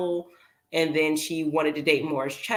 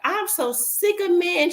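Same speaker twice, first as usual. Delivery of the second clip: put-on high, sassy teen girl voice; a fed-up teenager rolling her eyes; mocking teen girl; 11 semitones higher than usual. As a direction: mimic a jaded teenage girl. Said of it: "be talking like this".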